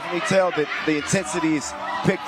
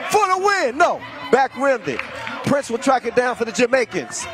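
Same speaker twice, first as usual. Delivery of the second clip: excited play-by-play; 7 semitones higher than usual. Acted. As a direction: call the action like the crowd's on its feet. Live play-by-play, excited call.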